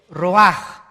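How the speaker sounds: loud "roaj" with a throaty j; Hebrew guttural. Shown as a demonstration. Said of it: "se pronuncia igual".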